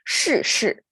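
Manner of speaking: 是, 是 with tongue curled, both dropping from a high start; curled-tongue falling tone. Shown as a demonstration. Teacher shows learners the difficult pair, 是, 是 both curled and falling. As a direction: demonstrate 是, 是 as two falling-tone syllables with curled tongue. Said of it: "they are both falling tone".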